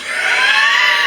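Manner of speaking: high burst of laughter; real high cackle; hard laughing. Real, not acted. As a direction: crack up at your own punchline. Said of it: "I'm laughing so fucking hard".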